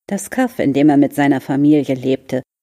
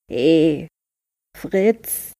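hoarse turtle voice; 2 semitones higher than usual. A narrator's reading. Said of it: "sagte in diesem Moment eine heisere Stimme".

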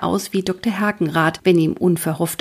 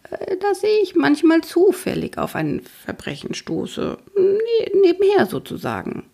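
an embarrassed man whose voice cracks upward; squeaky cracking voice; 13 semitones higher than usual. Acted. Rory explains his side cases, voice squeaking high. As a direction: voice jumps much higher and squeaks. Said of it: "Rorys Stimme wird mit einem Mal ganz kieksig".

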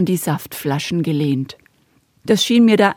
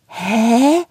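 stunned; 5 semitones higher than usual. Acted. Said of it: "fragte ich fassungslos".

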